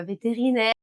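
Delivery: ordinary talk, nothing marked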